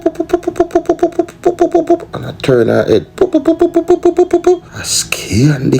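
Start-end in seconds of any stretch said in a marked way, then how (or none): 0.0-2.1 rapid high-pitched boop noises; chirpy high-pitched beeping sounds. Retold or imitated. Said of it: "So she goes up Boop". 3.2-4.8 rapid high-pitched boop noises; chirpy high-pitched beeping sounds. Retold or imitated. Lady keeps booping.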